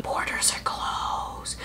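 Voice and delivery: hushed, drawn-out whisper; stage-whispered aside, very slow; mock whisper. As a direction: whisper it slowly like an open secret. Mock-secret aside in a rant.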